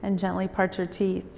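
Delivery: calm, even meditation voice with long pauses; guided meditation. Real, not acted. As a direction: guide listeners unhurriedly into stillness, phrase by phrase.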